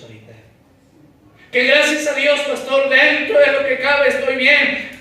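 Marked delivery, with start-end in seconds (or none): 1.5-4.9 loud, fervent preaching to the congregation; impassioned pulpit delivery; full-force preaching. Acted. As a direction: preach it out with fire.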